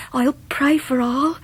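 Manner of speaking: normal talking voice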